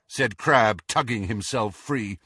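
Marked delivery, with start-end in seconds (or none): none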